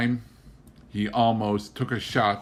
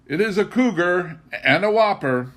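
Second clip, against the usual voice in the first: high, loud and delighted; joyful announcement; 8 semitones higher, 5 dB louder than usual. A narrator's reading. Husband telling his wife about the cougar, joyfully. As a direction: proudly announce the big find. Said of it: "he joyfully announced".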